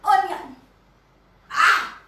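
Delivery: loud, high angry shout; angry yell. Acted. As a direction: burst in furious. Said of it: "started to yell".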